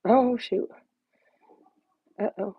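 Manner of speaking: ordinary delivery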